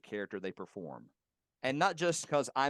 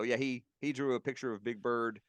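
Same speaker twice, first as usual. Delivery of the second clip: ordinary talk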